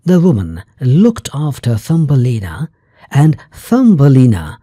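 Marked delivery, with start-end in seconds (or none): none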